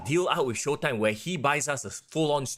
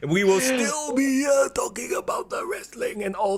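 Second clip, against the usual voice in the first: put-on grumpy old man; 6 semitones higher than usual. Imagining being old wrestling hosts.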